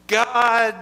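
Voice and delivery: shout. Shown as a demonstration. Leading children to shout the message, loud and high. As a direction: shout it out to the whole room. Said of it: "we're gonna shout".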